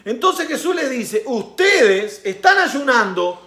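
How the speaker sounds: loud, high-pitched sermon with laughs; fiery preaching. Acted. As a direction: preach it at full force. Preaching at full force. (predicación fervorosa a todo pulmón)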